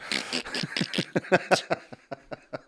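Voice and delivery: clicking disposable-camera wind-on sound; imitated camera noise. Shown as a demonstration. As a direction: mimic a film camera winding on. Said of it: "what that noise is".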